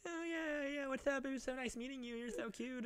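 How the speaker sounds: high, soft mock girl voice.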